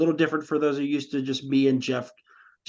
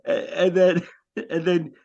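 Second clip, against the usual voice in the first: normal talking voice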